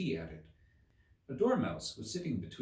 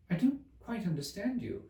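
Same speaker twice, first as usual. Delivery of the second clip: polite; 11 semitones higher than usual. A narrator's reading. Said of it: "as politely as she could".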